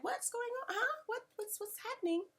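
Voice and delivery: soft, high, puzzled asking; confused whisper-quiet. Retold or imitated. Vlogger retelling her own confusion, soft and high. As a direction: murmur in bewilderment. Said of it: "I was like".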